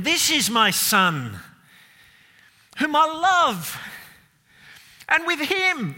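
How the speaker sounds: God the Father's booming voice. Acted. Preacher voicing the Father shouting from heaven, proud and booming. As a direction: call down from heaven bursting with pride. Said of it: "He speaks audibly from heaven".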